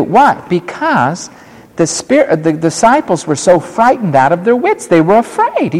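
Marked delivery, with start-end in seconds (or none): none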